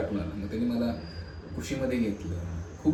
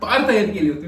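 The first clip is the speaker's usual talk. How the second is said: high, loud, insistent outburst; worked up and repeating himself loudly; overwrought excitement; 8 semitones higher, 6 dB louder than usual. Acted. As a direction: burst out with it, voice pitched high. Jilted groom recalls his eager preparations.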